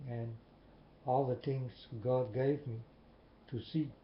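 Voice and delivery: ordinary delivery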